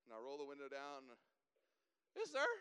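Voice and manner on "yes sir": very high, loud panicked squeak; squeaky nervous voice. Retold or imitated. Startled driver answering a state trooper, nervously squeaky. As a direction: voice cracks up high, trying to answer politely. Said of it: "Your voice gets, like, way squeakier".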